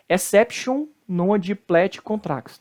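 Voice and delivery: exception said as English, ending in -shun; slow mispronounced Latin, English "exception" ending; wrong English-style pronunciation. Shown as a demonstration. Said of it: "falam assim".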